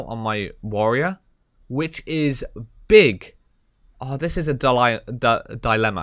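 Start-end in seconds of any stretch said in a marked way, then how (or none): 4.2-6.0 false starts on "dilemma" before getting it out; tongue-tied stumble. Real, not acted. Streamer torn over loot choice, tongue-tied on "dilemma". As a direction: trip over "dilemma", restarting it twice. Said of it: "I can't even say that word right now".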